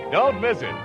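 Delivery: ordinary talk, nothing marked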